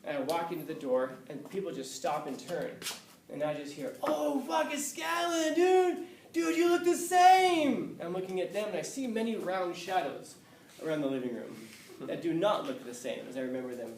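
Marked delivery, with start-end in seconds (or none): 4.0-8.0 high excited shout, stretching out the last words; excited yelling. Retold or imitated. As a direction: bellow it like a drunk old buddy spotting you. Old classmates greeting him loudly.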